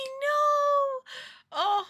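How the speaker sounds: high loud dismayed cry. Real, not acted. Gamer dismayed at the crispy towel, high loud cry. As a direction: wail it at the ruined towel.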